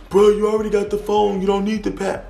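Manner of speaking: his whiny voice. Retold or imitated. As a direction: protest like a caught man. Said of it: "his reply".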